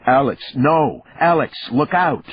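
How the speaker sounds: loud, high urgent warning shout; calling out a warning. A narrator's reading. Partner warning Alex of the attacker, shouting. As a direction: yell across the room to save a friend. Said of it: "I heard Sampson call out".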